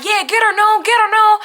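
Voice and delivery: high, loud egging on; excited egging on. Retold or imitated. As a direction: urge her on like a cheering aunt. Aunt cheering from the phone.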